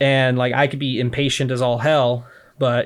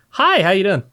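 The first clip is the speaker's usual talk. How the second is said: bright, much higher, louder greeting; customer service voice; 8 semitones higher, 4 dB louder than usual. Shown as a demonstration. Greeting a customer at the counter in a chipper service voice. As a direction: switch on the fake-friendly shop voice. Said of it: "what could be called a customer service voice".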